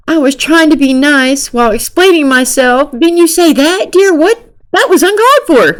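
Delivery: high-pitched mock bewilderment; confused protest. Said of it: "Nick is so confused".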